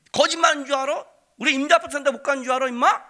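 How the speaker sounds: quick, high, loud brag; fast gloating taunt. Retold or imitated. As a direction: rub it in their faces. Son gloating at friends.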